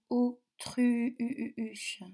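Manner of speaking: "autruche" with the U held and pulsed, "o-tru-u-uche"; teacher drawing out "autruche" on its U; stretched vowel. Shown as a demonstration.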